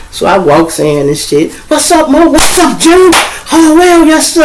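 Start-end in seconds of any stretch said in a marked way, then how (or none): 1.7-4.4 put-on much higher, louder hype greeting to the boys. Arriving at the bar, greeting the guys in a high, loud voice. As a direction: breeze in greeting everyone, pitched way up.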